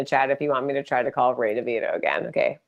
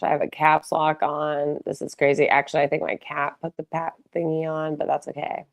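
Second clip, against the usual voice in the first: usual speaking voice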